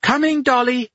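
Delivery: loud high yell back. A narrator's reading. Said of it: "Anna yelled back".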